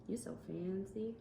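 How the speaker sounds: ordinary delivery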